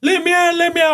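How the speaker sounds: high-pitched mock panic from the mother; mum's panicked plea. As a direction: imitate a panicking mum begging to get out. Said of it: "she's fucking crying".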